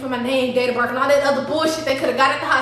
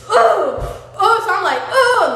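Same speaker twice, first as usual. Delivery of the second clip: pained grunts; 5 semitones higher, 4 dB louder, slower than usual. Retold or imitated. Carried down the stairs.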